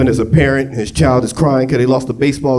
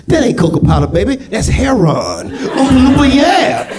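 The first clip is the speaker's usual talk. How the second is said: jive Oompa Loompa; 13 semitones higher than usual. Pretend. Oompa Loompa warning Charlie, rowdy jive shout. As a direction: hype up Charlie's high. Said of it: "Here come the Oompa Loompas".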